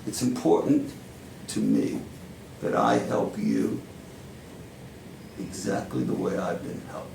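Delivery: put-on deliberate New York-accented stage monologue, long pauses; Al Pacino-like monologue. Powerful mentor drawing Joe in like family, Pacino-style monologue. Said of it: "channeling Al Pacino".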